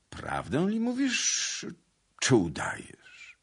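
slow, high rise sinking to near whisper; changed voice. A narrator's reading. Suitor astonished by his lady's rebuke, changed voice. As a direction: stretch the question, then drop the voice. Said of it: "spytał zmienionym głosem".

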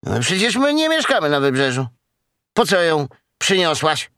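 high, quick questioning; puzzled animal youngster. Acted. Character questions the cow.

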